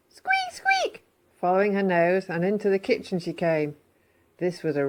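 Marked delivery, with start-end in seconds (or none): 0.1-1.0 high little squeak; squeaky mouse. Acted. Missus Mouse scurrying along, high squeak.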